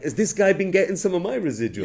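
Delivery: high, loud incredulous question; rising in disbelief at the mix-up; shocked disbelief. Retold or imitated. Phoning the union over wrong payments, in shocked disbelief. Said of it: "And I was like".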